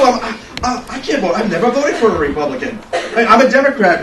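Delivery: lightly hammed-up distress; mock flustered protest. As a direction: sputter like a flustered friend. Acting friend's flustered protest.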